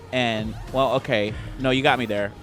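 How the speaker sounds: ordinary talk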